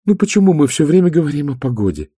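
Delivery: sighing complaint. A narrator's reading. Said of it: "вздохнула".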